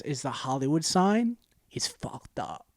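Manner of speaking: slow voiceless whisper; hushed whisper. Acted. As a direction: pitch it like a secret trailer. Whispered movie pitch.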